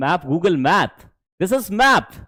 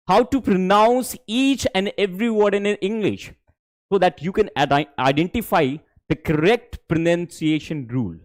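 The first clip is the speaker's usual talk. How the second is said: very slow, deliberate English; slow clear English; much slower than usual. Shown as a demonstration. Teacher states the lesson aim slowly to students. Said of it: "slow English read".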